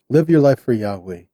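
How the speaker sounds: normal talking voice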